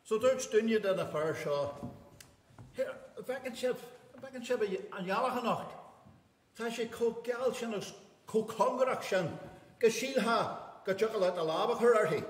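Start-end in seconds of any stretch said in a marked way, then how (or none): 2.7-12.2 a fella's voice, pitched higher; excited fella. Acted. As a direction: marvel at the moon, higher.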